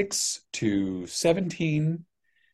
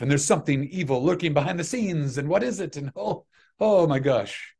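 climbing higher into hammed-up intrigue; mock-suspense teaser; 4 semitones higher than usual. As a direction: play up the mystery like a trailer, pitch rising. Hamming up own book's teaser.

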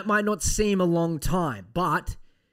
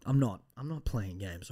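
ordinary talk

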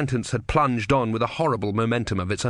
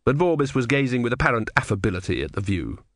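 normal talking voice

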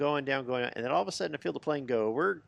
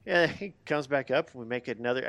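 normal talking voice

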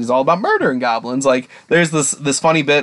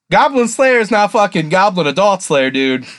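ordinary talk, nothing marked

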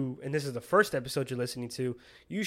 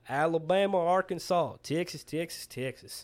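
sing-song country twang warm-up; country accent; 3 dB louder than usual. Shown as a demonstration. Showing his cue for getting into character, country accent. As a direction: repeat it in a country twang, settling down. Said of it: "if you ever need to do a country accent".

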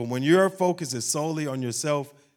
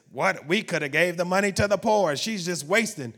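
higher-pitched, indignant objection; upset disciples; 5 semitones higher, 4 dB louder than usual. Acted. Disciples objecting to the woman's waste of money, upset. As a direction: protest in a raised, upset voice. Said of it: "the disciples were upset with her".

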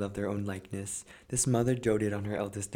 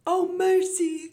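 very high, loud, drawn-out shriek; horrified cry; 7 dB louder, slower than usual. A narrator's reading. Mother seeing vipers from her daughter's mouth, horrified shriek. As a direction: recoil in horror. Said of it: "cried the mother".

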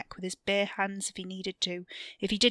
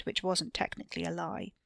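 plain reading voice

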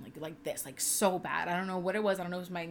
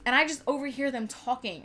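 normal talking voice

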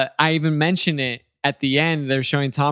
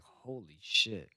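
exhausted fighter; 6 semitones lower, 12 dB quieter, much slower than usual. Retold or imitated. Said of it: "Marquez is like".

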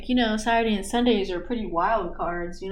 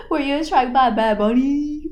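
mock gasp, teasing; 5 semitones higher, 4 dB louder than usual. Joking about [name].

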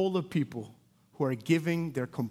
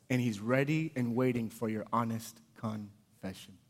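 ordinary talk, nothing marked

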